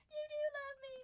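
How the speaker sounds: very high, squeaky cooing; touched pet owner. Acted. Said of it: "You're like".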